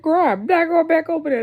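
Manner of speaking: telling Corey she won't go back; loud refusal. Retold or imitated. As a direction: throw it back at her loud.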